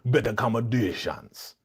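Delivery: rhyming, hammed-up Jamaican patois; mock Jamaican accent. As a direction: parody a bad Jamaican accent, drawing out each rhyme. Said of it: "Jamaican accent".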